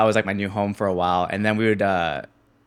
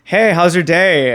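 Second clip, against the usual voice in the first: slow, high and louder casual greeting; friend's call; 8 semitones higher, 8 dB louder, slower than usual. Retold or imitated. Broke friend calling from a U-Haul, forced cheer. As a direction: sound cheerful while hiding tears. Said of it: "He's like".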